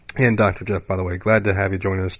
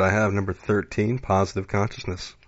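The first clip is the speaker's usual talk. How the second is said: normal talking voice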